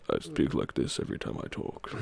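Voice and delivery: very low and soft; sexy deep voice. Acted. Streamer mimicking an actor's sexy voice, deep and soft. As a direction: sink into a hushed, deep seductive voice. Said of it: "Sexy voice".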